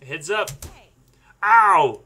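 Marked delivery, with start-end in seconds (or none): 1.4-2.0 sudden pained ow; loud yelp. Real, not acted. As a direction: yelp as it hits you. Hit in face by frisbee.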